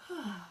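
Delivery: soft breathy sigh; gentle demonstration sigh; relaxing sigh. Shown as a demonstration. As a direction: let the air go in a sigh. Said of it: "the sigh is a very relaxing".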